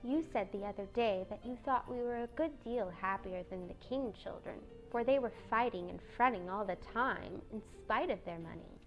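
soft, small, mild voice; meek, hushed little sister; gentle Beth. A narrator's reading. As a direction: remind her gently and quietly.

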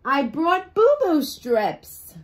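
high, slow creature voice; small sea creature bringing bandages; slow high little voice. Acted. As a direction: offer it slowly and sweetly.

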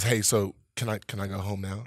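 low, soft, hesitant ask; sheepish request. Retold or imitated. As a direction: imitate a friend timidly asking to leave. Friend sheepishly asks to leave.

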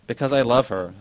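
ordinary delivery, nothing marked

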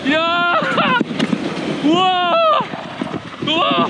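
high excited whoops down the slope; sledding downhill. Real, not acted. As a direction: yell with joy while sliding.

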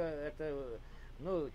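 ordinary talk, nothing marked

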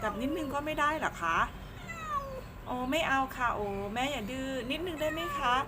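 high, pleading pet-talk; small high coaxing voice; cutesy small voice. Real, not acted. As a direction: wheedle a stubborn cat sweetly. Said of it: "แม่ทำเสียงเล็กเสียงน้อย".

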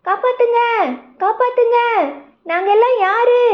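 high, panicked cries for help; frightened shrill wail for help; panicked screams. Acted. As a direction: shriek it like scared animals. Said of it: "எல்லாரும் அலறினாங்க".

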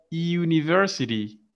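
university with the r sounded, American style; American English university, r kept; American pronunciation. Shown as a demonstration. Teacher showing the class, American English university. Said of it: "in American".

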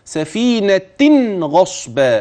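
سفينة said with a clear nun sound before غصبا; recited سفينة غصبا with a nun; clear tanween nun. Shown as a demonstration. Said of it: "طب اسمع كده".

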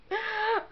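quick high giggle at herself; a short sheepish giggle; embarrassed laugh. Real, not acted. Laughing at her own slurred words to viewers, giggle.

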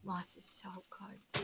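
soft breathy whisper of delight. Real, not acted. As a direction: whisper it lovingly. Softly cooing over moss.

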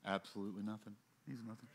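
very soft, low throwaway punchline; muttered aside. Real, not acted. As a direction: drop it almost under your breath.

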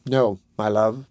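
slow, subdued reply; tender, unhurried regret; gently sad. A narrator's reading. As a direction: answer slowly, with understated sadness. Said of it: "looking sadly back".

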